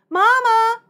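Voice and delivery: loud high call to Mama. Acted. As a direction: call across the woods. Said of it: "she called".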